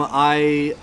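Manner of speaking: normal talking voice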